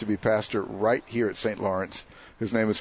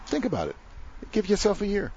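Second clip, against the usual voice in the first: put-on Irish accent; 11 semitones higher, faster than usual. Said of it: "It's an Irish accent".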